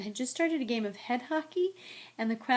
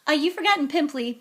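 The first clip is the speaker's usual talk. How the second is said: high, quick, loud taunt; taunting hiss; 6 semitones higher, 9 dB louder, faster than usual. A narrator's reading. Peeves needling the tearful ghost in her ear, taunting. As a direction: jab it in fast and mischievously. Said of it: "Peeves hissed in her ear".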